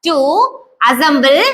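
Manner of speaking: each word drawn out very slowly for the class; slow dictation. Shown as a demonstration. (மிக மெதுவாக dictation சொல்வது)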